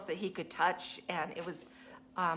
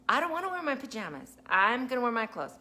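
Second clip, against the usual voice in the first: quick, higher mock child refusal; protesting child; 5 semitones higher, 4 dB louder, faster than usual. Four-year-old refusing pajama day, mock protest. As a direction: play a small kid digging in.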